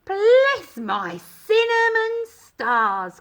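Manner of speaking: loud, slow, high exclamation of dismay; startled cry. A narrator's reading. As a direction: cry out in shock at the escaping cookie. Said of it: "cried Missus Baker".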